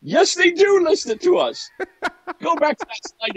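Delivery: high laughing correction; laughing at himself. Real, not acted. Correcting his slip to his cohost, laughing. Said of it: "Oh, did I just say that?".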